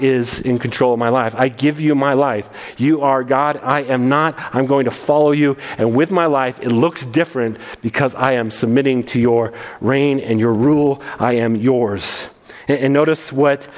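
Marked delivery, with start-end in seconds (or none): 1.4-12.3 lower, earnest prayer voice; slowing on the final I am yours; surrender prayer. Acted.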